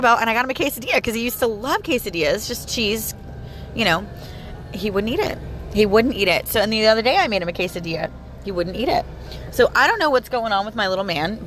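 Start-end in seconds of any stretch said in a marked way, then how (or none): none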